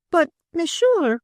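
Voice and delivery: high, slow, wounded French madame; shocked, grieved protest. A narrator's reading. Frenchwoman protesting to a demanding man, shocked and grieved. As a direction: protest in a high voice, delicately offended. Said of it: "the grieved intimation of indelicacy in her voice".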